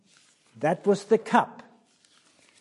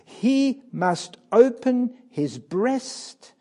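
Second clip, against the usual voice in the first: rising, louder pulpit preaching; impassioned preaching; 4 semitones higher, 5 dB louder than usual. Acted. Preacher pressing Christ's choice on the congregation, fervent. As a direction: drive the question home to the congregation.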